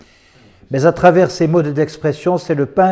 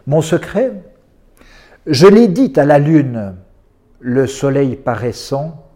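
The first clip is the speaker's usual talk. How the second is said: poetry recital; slower than usual. Acted. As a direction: recite each poem with long, suspended pauses. Lit des poèmes à voix haute. (lecture de poésie lente et posée)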